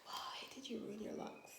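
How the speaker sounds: hushed, breathy plea to herself; soft disbelieving whisper at her own mistake; whispered self-scolding. Retold or imitated. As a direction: whisper it like scolding yourself in the mirror. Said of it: "and I'm just like".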